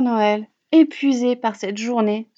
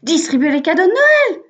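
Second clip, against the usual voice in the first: higher and louder, eager reply; enthusiastic; 5 semitones higher, 6 dB louder than usual. A narrator's reading. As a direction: announce it with enthusiasm. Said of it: "répond avec enthousiasme". (réponse enthousiaste, plus forte)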